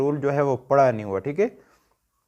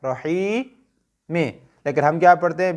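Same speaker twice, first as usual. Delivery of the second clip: ordinary delivery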